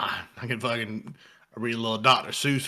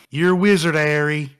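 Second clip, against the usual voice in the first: mock Southern accent; 4 semitones higher, 8 dB louder than usual. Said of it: "Give me your best Southern accent".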